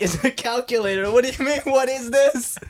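high, laughing disbelief; laughing indignation. Retold or imitated. Student answering the teacher, laughing in disbelief. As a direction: scoff at a silly question through laughter.

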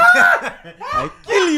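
laughing through a slow, loud quote; hammed-up laughing threat; laughing mock threat.

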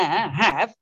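"have" drawn out with a wavering tune; pointed, lingering emphasis on "have"; pointed stress on have. Retold or imitated. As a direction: make the point on "have", stretching it. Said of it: "get the point in there".

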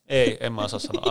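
ordinary talk

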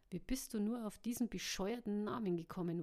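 ordinary delivery

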